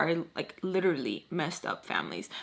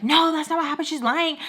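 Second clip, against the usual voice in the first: loud, high-pitched acted objection; heated protest; 9 semitones higher, 5 dB louder than usual. Arguing back at father would escalate things, heated protest. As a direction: burst out in mock indignation. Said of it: "it's gonna escalate".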